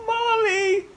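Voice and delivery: high, loud joyful call; yelled. A narrator's reading. Bunny yelling happily for his mommy. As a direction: call out to mom in delight. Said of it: "he yelled".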